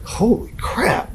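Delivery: high, slow, drawn-out astonishment; retold amazement. Musician retells landing on Billboard's list, slow amazed exclamation. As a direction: stretch it out in amazed disbelief. Retells reaction to chart placing.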